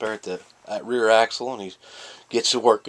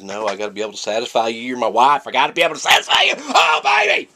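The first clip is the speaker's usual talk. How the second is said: overexcited husband; 2 semitones higher, 8 dB louder, faster than usual. Acted. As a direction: race faster and louder into a mock climactic yell. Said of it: "he just gets all excited".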